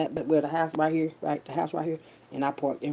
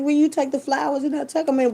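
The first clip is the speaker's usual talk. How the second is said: quoting coworkers; 10 semitones higher, 5 dB louder than usual. Retold or imitated. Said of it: "They were like".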